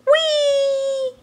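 very high, gleeful squeal; excited whee. Acted. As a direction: let out a thrilled squeal. Elmo making noisy noise.

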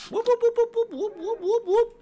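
high, loud string of whoops; high whooping. Real, not acted. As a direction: whoop loudly up in a high pitch. Startled noises while playing.

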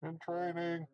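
high voice calling from far off; distant call. Acted. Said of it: "very far off in the mist".

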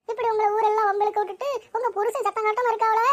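ordinary talk

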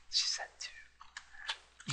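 breathy whispered aside. Real, not acted. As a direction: drop to a whisper. Aside after character speaks.

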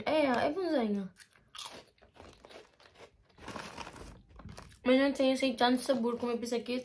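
eating crunchy snack while speaking; chewing while talking. Real, not acted. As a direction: mouth partly full of cracker. Seen 1: Bites crackers repeatedly; food visible in mouth at +5.0.

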